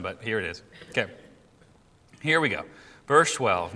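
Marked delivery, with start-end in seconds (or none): none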